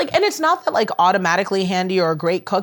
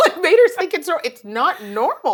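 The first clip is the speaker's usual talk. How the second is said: laughing; 9 semitones higher, 3 dB louder than usual. Real, not acted. Laughing mid-sentence.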